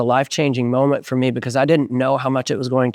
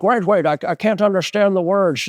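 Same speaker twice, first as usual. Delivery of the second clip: put-on Scottish-accented slurred sibilants, higher pitch; Sean Connery; 6 semitones higher than usual. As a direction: imitate a director with a Scottish accent, s turned to sh. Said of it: "he also sounded like Sean Connery".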